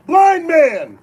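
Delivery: loud, high emergency call; demonstration yell. Shown as a demonstration. Host showing players the injury call, yelling it. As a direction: yell it out as an alarm to the whole field. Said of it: "and yell".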